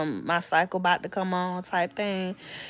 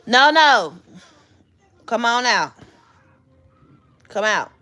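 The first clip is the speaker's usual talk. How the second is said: calling out; 2 semitones higher, 6 dB louder than usual. Real, not acted. Mom calling her son out of hiding. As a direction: call him out firmly.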